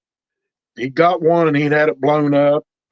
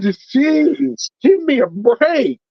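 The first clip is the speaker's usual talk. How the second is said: pitched way up in mock exasperation; retold huff at being scolded like a kid; exasperated; 9 semitones higher, much slower than usual. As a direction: throw up your hands, jeez, give me a break. Exasperated rookie retelling mentor's scolding.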